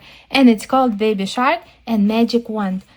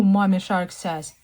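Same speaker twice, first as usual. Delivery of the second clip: ordinary delivery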